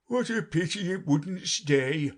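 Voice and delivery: slow, regretful bird voice; sighing Lory. A narrator's reading. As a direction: sigh it out sadly. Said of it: "sighed the Lory".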